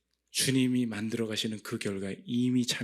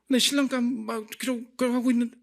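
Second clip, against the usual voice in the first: high, excited, half-laughing gush; giddy excitement; 12 semitones higher, 5 dB louder, faster than usual. Retold or imitated. Daydreaming of a groom mid-prayer, giddy and high. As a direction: get carried away with the fantasy.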